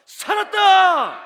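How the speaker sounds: long, high, full-throated shout; korean military style. Shown as a demonstration. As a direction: bellow it like soldiers on parade. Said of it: "목소리 크면 그 부대는요, 상 받아요".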